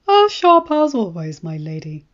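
a hint of indulgent Varys. A narrator's reading. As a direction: pay the compliment with a fond smile. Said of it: "Varys smiled indulgently".

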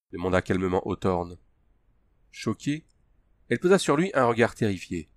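ordinary delivery, nothing marked

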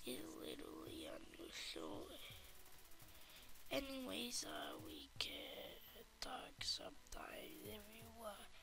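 very soft unvoiced whisper. Kid whispering to a game character. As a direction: barely breathe the words.

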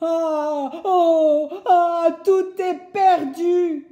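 high, loud, slow despairing wail; woodcutter crying out. Acted. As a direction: wail in defeat under the stings. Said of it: "crie le bûcheron". (cri désespéré, aigu et fort)

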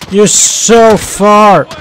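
very slow, drawn-out taunt; stretched tease. Real, not acted. Gamer mocking a far-off teammate, very slow drawn-out taunt. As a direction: stretch every word as a lazy taunt.

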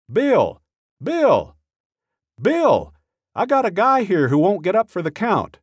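shouting across the cell house for his colleague; retold yelling. Sergeant yelling urgently to the officer about a guy who won't get up. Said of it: "he starts yelling".

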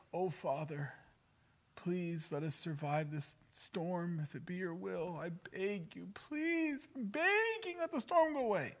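whimpering mock plea climbing very high; hammed-up pleading prayer, building in pitch; mock begging. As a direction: imitate desperate begging, slow then shrieky-high. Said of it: "He doesn't beg".

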